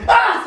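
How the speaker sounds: very high, loud comic shriek; scream. Acted. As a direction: shriek in panic, close to laughing. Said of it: "Said the farmer".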